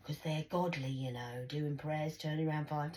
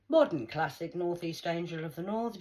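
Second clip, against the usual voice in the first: usual speaking voice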